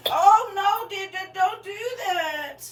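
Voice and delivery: high startled cry, slowing; alarmed cry. Real, not acted. Host watching her shelf items fall, high alarmed cry. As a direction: yelp as stuff falls.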